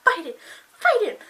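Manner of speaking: high, loud urging; excited cheering. Retold or imitated. Fan cheering her on in the fight, high excited shout. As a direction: egg the fighter on.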